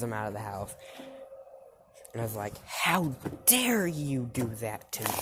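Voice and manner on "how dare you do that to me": higher indignant acted outburst; outraged. Mom throwing Deku out, outraged. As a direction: hit DARE with indignation.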